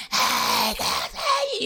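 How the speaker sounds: high, thin mock yell; retold yelling. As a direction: imitate cheering from the crowd without full volume. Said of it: "we were yelling".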